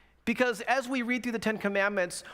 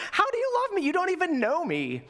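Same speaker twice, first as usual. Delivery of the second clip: very high, incredulous mock retort; sarcastic and bitter; 10 semitones higher than usual. Friend to little girl she doesn't know, imitated sarcasm. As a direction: throw it back with hammed-up bitter disbelief. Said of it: "was in a super sarcastic, bitter mood".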